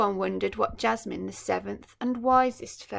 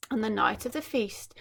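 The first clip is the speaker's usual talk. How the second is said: usual speaking voice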